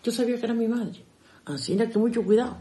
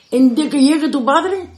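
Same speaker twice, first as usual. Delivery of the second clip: raised, higher threat about the father; mother's threat; 8 semitones higher, 9 dB louder than usual. Retold or imitated. Mother warning her daughter, raised threatening voice.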